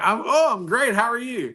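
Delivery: high-pitched, upbeat quoted greeting; delighted greeting. Retold or imitated. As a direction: act out a pleasantly surprised hello. Retold reply to a stranger's greeting.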